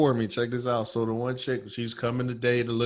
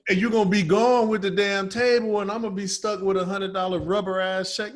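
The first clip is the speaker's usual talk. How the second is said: higher, louder indignant complaint; exasperated rant; 7 semitones higher, 4 dB louder than usual. Retold or imitated. To the buyer offering a bad check, exasperated rant. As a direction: spell out the scam.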